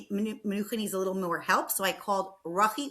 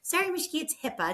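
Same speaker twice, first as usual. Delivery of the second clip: imitating Macy; 8 semitones higher, faster than usual. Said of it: "she always says".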